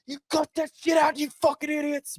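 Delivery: very high, strangled mock yell, held back in volume; Saban. Coach running across field at his players, mock furious yell. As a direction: imitate a coach about to burst with rage. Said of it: "about to have an aneurysm".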